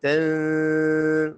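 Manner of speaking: تن with the n held as a long hum; ikhfa with ghunna. Shown as a demonstration. Teacher models ikhfa for learners, long nasal hum on تن. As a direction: hum the noon of تن through the nose, steadily. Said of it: "اخفا کریں".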